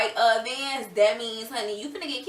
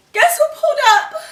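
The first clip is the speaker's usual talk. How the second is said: much higher and louder, rising tease; excited reveal; 13 dB louder than usual. Shown as a demonstration. Teller hypes viewers before dad arrives, loud excited tease. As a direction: build suspense like a big reveal. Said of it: "and guess who pulled up?".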